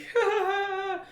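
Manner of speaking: high, wheezy string of giggles; imitated stoned laugh. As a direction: mimic giggling while toppling over. Acting out falling over stoned.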